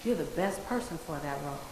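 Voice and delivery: ordinary talk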